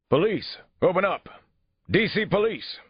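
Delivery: high, loud police call through a door; shouted police order. Acted. Detective shouts to those inside the apartment, urgent shout.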